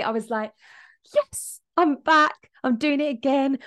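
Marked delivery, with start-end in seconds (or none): 1.1-3.6 triumphant joy. Retold or imitated. Triumphant inner voice, life great.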